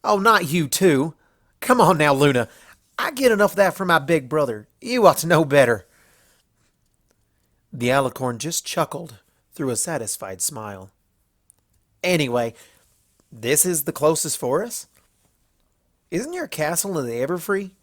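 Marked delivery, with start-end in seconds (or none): 0.0-5.9 higher, louder exasperated farm mare; a playful scolding of an old friend; Applejack mock scolding. A narrator's reading. 12.0-17.7 a light country ribbing; Applejack's teasing voice. A narrator's reading. Applejack asks Luna.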